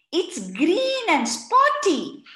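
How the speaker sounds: put-on high, slow child voice; child's voice. A narrator's reading. Akif describes frog in high slow child voice. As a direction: sound like an eager little boy. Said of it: "said Rani".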